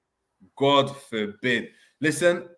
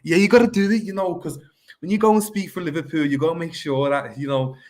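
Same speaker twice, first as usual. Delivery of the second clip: high, playful put-on Scouse; Scouse accent; 8 semitones higher, 5 dB louder than usual. Explaining how to speak for Liverpool, in a Scouse accent. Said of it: "Hey, your Scouse accent's proper".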